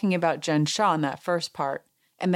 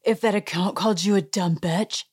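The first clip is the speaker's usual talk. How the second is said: mock-upset challenge in a put-on voice; acted-out upset retort; upset confrontation; 3 semitones higher than usual. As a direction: imitate someone indignantly demanding an answer. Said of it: "she's all upset".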